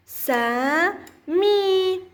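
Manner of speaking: سا and مي held long, apart; syllable by syllable. Shown as a demonstration. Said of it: "سأقرأهم معًا".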